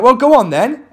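the heckler's high, loud taunt; mock heckler challenge. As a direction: dare him to get on with it. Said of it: "He's like".

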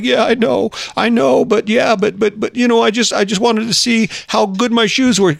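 put-on high-pitched kid stammering out an excuse; child making excuses. Son excusing his wall-kicking to dad, childish excuse-making. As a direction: play a boy wheedling his way out of blame. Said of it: "Makes up some excuse".

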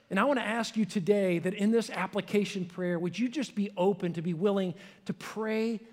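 usual speaking voice

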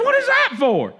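student yelping after a smack; startled squawk. Retold or imitated. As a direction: squeak it like you just got smacked. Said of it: "He says".

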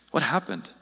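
What happened dropped low and soft, falling away; soft sad question. Retold or imitated. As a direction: ask it quietly, almost to yourself. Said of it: "And so he's like".

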